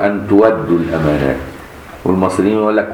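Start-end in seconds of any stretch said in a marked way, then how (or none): 0.0-1.4 تودوا said as wadu with no glottal stop; تودوا without hamza. Shown as a demonstration. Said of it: "ورش وأبو جعفر يقول". (تودوا بغير همز)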